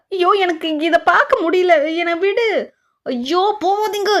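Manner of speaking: high, pleading mock panic; acted panic. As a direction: wail it like a flustered aunt. Panicked women see him leaving. (நாடகத்தனமான பதற்றம்)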